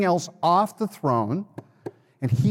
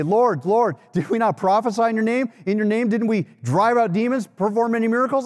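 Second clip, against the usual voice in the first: higher-pitched, insistent appeal; pleading protest; 7 semitones higher than usual. Acted. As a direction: plead your case in a raised pitch. Voicing the pleaders in scripture.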